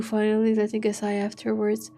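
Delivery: usual speaking voice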